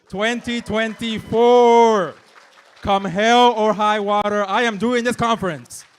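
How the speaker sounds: big, slow, booming welcome. Real, not acted. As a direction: boom it out to the hall. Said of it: "Ooh, I sound booming voice".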